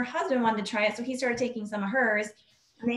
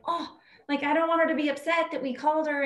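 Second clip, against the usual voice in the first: higher, anxious retelling of her own worry; worried; 7 semitones higher than usual. Retold or imitated. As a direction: sound afraid you upset the customer. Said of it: "I was like".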